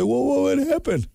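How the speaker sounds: high fake-shocked whoa; mock surprise. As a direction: play it dumb and startled. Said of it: "You're just gonna be".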